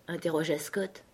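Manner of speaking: ordinary delivery, nothing marked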